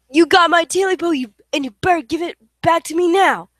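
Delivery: loud creature threat; creature's threat. Acted. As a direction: threaten him face to face. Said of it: "and said".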